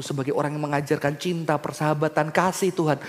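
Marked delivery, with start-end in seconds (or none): none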